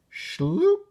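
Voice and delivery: high, rising cartoon "Schloop!"; sliding sound effect. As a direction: slide the sack along with a whoosh. Sack dragging sound.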